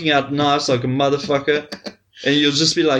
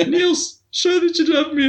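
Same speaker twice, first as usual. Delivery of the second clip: mock pleading; 12 semitones higher than usual. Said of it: "get him to do that video".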